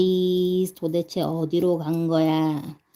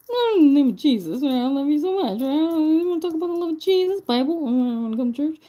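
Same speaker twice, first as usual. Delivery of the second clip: higher, louder, rushed gushing preacher voice; a goofy, deliberately bad Pope; Pope impression; 8 semitones higher, 3 dB louder, faster than usual. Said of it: "that's my impression of the Pope".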